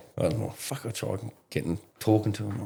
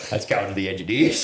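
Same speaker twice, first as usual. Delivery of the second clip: normal talking voice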